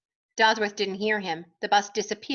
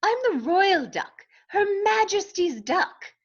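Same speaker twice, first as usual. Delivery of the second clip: put-on British accent; 6 semitones higher than usual. A narrator's reading. Duck asserts his royal rank, British accent. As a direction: proclaim it in a grand British accent. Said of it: "How is your accent so perfect".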